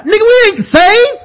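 high, hammed-up outrage; indignant outburst. Jesus rebuts crucified thief.

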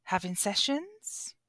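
slow, soft posh English lilt; a quiet plummy English rise; posh English accent. Acted. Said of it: "a posh English accent".

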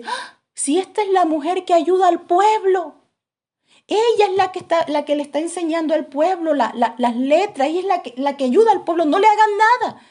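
high, loud, rushing cry of recognition; a prisoner suddenly realising who she is; excited recognition. Retold or imitated. Preso reconoce a la mujer. (reconocimiento agudo y emocionado)

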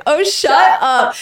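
high, slow, drawn-out "shut up"; mock annoyed snap. Wife retelling snapping at friend.

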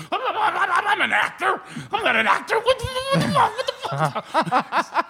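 high, stammering comic panic; imitating a panicked amateur actor; mock flustered protest. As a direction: stutter and squeal in exasperation.

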